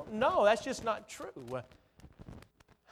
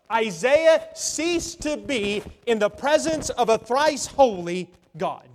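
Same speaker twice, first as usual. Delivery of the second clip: slow, louder, rising sermon delivery; forceful preaching; 4 semitones higher, 7 dB louder, slower than usual. Real, not acted. Preacher on Isaiah undone before a holy God, preaching hard. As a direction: build to full pulpit force, word by word.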